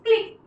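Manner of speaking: "क्लिक" with a quick, short ई. Shown as a demonstration. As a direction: snap through the vowel of "क्लिक". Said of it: "फटाक से ई का pronunciation होता है".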